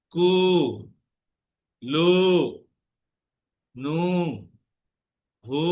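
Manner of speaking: each syllable ends in a clear rounded u; u vowel drill. Shown as a demonstration. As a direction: drill বু, জু, তু with a full oo sound, one at a time. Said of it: "ওয়াও এর আগে পেশ দিয়ে দিব আমরা".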